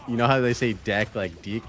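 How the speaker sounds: usual speaking voice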